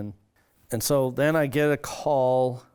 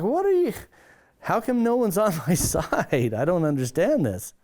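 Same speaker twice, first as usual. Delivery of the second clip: exasperated disbelief; 7 semitones higher than usual. Retold or imitated. Exasperated at being refused entry.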